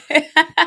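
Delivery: high, loud cackle of amusement; real high-pitched laughing fit. Real, not acted. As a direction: burst out laughing. Laughing after the news clip.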